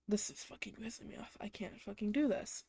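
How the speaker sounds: hushed angry whisper, no voice; fed-up whisper. Retold or imitated. Retelling her fed-up thoughts to viewers, in a whisper. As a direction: seethe it under your breath.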